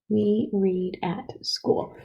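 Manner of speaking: put-on measured dictation for young writers; slow dictation. Shown as a demonstration. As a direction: read it slowly, word by word. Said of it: "So check your sentence".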